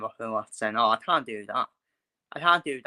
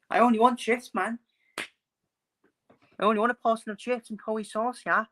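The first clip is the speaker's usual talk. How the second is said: high, whiny Scouse caricature; mock Liverpool accent; 10 semitones higher than usual. Scouse fan stuck in the chippy queue, high mock Liverpool accent. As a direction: complain in a put-on high Scouse voice. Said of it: "the Liverpool accent for me".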